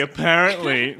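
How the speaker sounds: mock a bored newsreader; sarcastic newsreader. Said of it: "[name] as a sarcastic newsreader".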